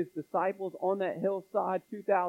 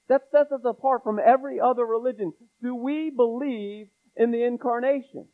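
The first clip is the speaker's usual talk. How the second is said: higher, louder preacher's proclamation; full-force preaching; 3 semitones higher, 4 dB louder than usual. Acted. Pastor exhorting his church about salvation, fiery preaching. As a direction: preach it out with fire.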